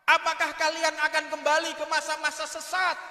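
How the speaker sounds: usual speaking voice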